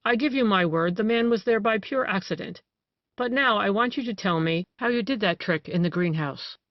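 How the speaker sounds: ordinary delivery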